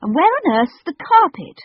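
high, loud anxious call; shouted. A narrator's reading. Robert shouting to the cook about the carpet, loud. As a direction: yell the question after her. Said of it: "Robert shouted".

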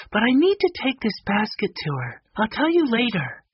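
very high-pitched character voice, eager; high storybook voice, busy and earnest; helpful Violet. Acted. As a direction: pitch it way up as a keen young helper.

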